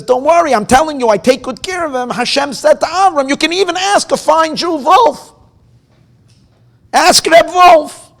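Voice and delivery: loud, high, booming reassurance; God reassuring Avram, loud and booming; grand divine voice. Acted. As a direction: proclaim it like God from above.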